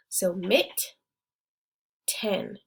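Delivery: "mit" then "ten", said apart with a pause; "mit" and "ten" as separate beats; split into syllables. Shown as a demonstration. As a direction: break "mit, ten" in two with a gap. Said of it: "we're gonna have mit and ten together".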